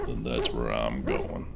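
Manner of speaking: low, slow put-on gravelly growl. Shown as a demonstration. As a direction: copy the dwarf's gravelly voice. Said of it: "I can have a artificial gravelly voice too".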